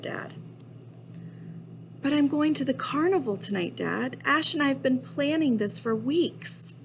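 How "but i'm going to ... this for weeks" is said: higher-pitched pleading objection; protesting kid. Acted. Kid objects to dad over carnival plans, protesting. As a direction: plead the case to a parent in a raised pitch.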